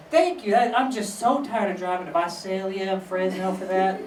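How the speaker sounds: put-on neighbor voice, starting high; imitated neighbor. Neighbor tired of driving to Fresno, put-on voice.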